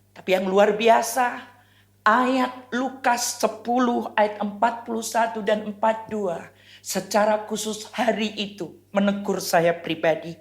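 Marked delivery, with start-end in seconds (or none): none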